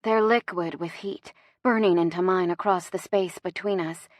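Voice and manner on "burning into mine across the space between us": ordinary delivery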